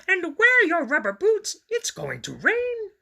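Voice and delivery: high, loud motherly call; mommy calling. A narrator's reading. Mommy reminding Grover about his boots, high and loud. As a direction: call after him as mommy.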